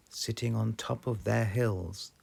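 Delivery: plain reading voice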